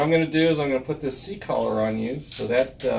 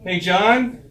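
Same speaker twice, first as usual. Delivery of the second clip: raised, higher-pitched hail; calling out; 8 semitones higher, 5 dB louder than usual. Acted. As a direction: call a colleague over. Calling a helper over.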